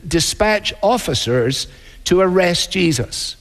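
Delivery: ordinary talk, nothing marked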